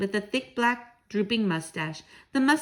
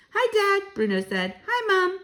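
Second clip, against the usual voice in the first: put-on squeaky mouse boy; 11 semitones higher, 4 dB louder than usual. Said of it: "mouse boy greets parents".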